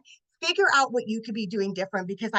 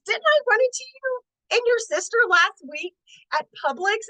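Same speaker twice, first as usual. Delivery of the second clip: much higher, bright excited recognition; excited high greeting; 12 semitones higher, 4 dB louder than usual. Retold or imitated. Carly in the shoe store, imitated much higher and excited. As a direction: imitate a thrilled girl recognizing a friend.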